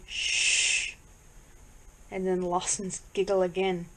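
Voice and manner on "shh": long unvoiced shush; sustained hushing hiss. A narrator's reading. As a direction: hush someone with a drawn-out "shh". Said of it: "then someone going".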